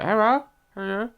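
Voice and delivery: high mock Chinese greeting; mock Chinese accent. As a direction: greet like the shop lady, high-pitched. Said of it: "She was just like".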